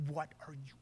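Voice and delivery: hushed, barely voiced alarm; mock under-the-breath scolding; imitated silent mouthing. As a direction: mouth it with hardly any sound. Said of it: "he's mouthing at me".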